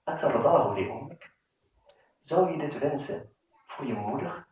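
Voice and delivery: low, hushed question in a close, intimate voice; whispered in his ear. Retold or imitated. The prophet softly asks the young man in his ear, hushed. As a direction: lean in and murmur it privately to him. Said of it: "en fluistert hem in en zegt".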